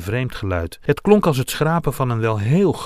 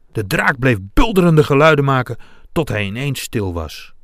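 ordinary delivery, nothing marked

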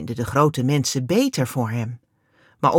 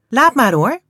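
quickly; 8 semitones higher, 7 dB louder than usual. A narrator's reading. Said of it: "zegt Jikke gauw".

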